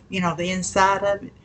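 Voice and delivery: normal talking voice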